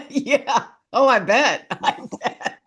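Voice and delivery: laughing through the words; chuckling while agreeing. Real, not acted. Laughing after jury joke.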